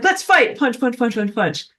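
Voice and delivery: playful pretend picking of a fight; mock fighter. Speaker mimes brawling with non-God stuff, mock combative.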